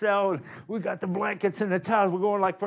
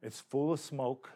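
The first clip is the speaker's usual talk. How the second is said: ordinary talk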